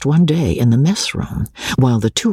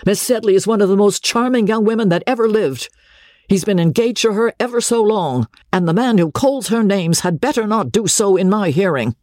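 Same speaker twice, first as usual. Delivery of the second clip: high-pitched, heated outburst in someone's defence; indignant blurting; 8 semitones higher than usual. A narrator's reading. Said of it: "couldn't help blurting out".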